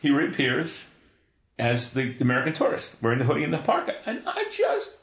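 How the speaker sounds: high voice breaking with real tears; choked up retelling. Real, not acted. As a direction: push through the story while starting to cry. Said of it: "I'm thinking about this right now".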